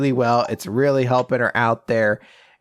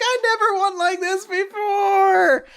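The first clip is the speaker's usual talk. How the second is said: high, slow mock sob of joy; happy crying; slower than usual. A girl crying over her first win, mock joyful sob. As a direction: wail it like tears of happiness.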